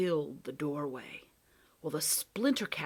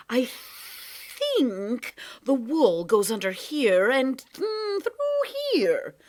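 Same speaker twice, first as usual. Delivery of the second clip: higher, slow sing-song cat with a chuckle; sly cat; 7 semitones higher, 7 dB louder, slower than usual. Acted. Cat winding wool round Lindy, teasing. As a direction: tease it out like a playful trickster.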